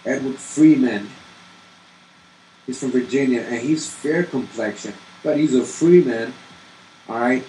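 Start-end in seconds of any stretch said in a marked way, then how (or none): 5.2-6.3 free pushed out louder and higher; stress on free. Real, not acted. Teacher stressing free to show it isn't a surname. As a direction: lean hard on the word free.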